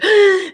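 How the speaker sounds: sharp high gasp of alarm; panicked gasp. Pretend. Coach acting a startled reactor, sharp panicked gasp. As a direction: gasp like you just got bad news.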